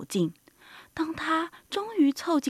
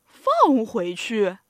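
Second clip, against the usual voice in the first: low, slow, loud echo; astonished, stretched-out query; incredulous question; 4 semitones lower, 5 dB louder, much slower than usual. Acted. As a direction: repeat it in drawn-out disbelief. Animals ask together in disbelief.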